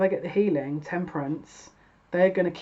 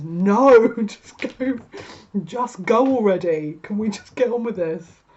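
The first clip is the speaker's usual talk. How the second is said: exasperated laughing push; 3 semitones higher, 7 dB louder, slower than usual. Real, not acted. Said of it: "exasperated urging".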